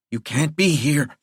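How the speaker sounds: usual speaking voice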